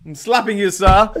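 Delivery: hammed-up threat, loud on the last word; mock outrage. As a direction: play the affronted gentleman. Mock threat to slap co-host.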